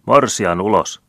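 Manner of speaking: chanting mob shout. A narrator's reading. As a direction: bellow it like a mob outside. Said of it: "huudettiin ulkona".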